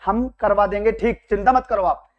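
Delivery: ordinary talk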